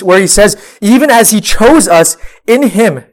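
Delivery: ordinary delivery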